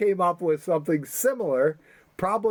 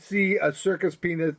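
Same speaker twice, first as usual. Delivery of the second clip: normal talking voice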